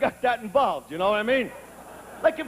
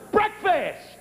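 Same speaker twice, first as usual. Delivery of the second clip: lightly voiced bossy child, pitched up; demanding little kid; 6 semitones higher than usual. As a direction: pipe up like an impatient kid making demands. Kid repeats demand to sleeper.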